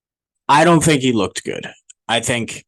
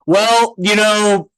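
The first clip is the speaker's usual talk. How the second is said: slow, higher-pitched mimicked reply; mock interviewee; 8 semitones higher, 4 dB louder, much slower than usual. Host imitates Rodgers answering an interview, mock drawn-out voice.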